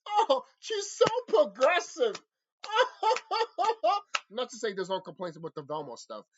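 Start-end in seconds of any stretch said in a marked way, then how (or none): 0.1-2.2 high, slow sneering fan voice; mocking high voice. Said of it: "When it comes to Velma". 2.6-4.2 high laugh. Real, not acted. Laughs at own impression.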